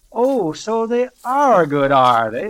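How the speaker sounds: loud, drawn-out indignant retort; scornful, forceful outburst; storming. A narrator's reading. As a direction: storm back, high then dropping, very loud. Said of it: "Miss Tarkington stormed".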